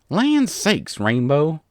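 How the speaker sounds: loud, high exclamation of protest; crying out. A narrator's reading. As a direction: cry out, exasperated at being woken. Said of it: "Applejack cried".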